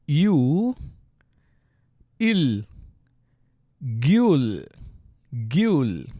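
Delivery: "you", "il" held, then blended "gyul"; sounding out syllable. Shown as a demonstration. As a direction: build "gyul" from long separate sounds. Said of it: "the next syllable is".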